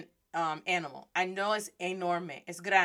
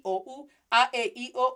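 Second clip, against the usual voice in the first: normal talking voice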